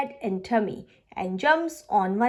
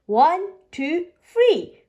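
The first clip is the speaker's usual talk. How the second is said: counting up excitedly; 6 semitones higher, 5 dB louder, slower than usual. Acted. Counting spins, rising loud.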